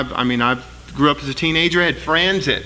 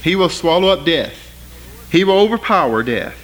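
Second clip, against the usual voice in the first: ordinary talk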